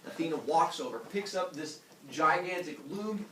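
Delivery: usual speaking voice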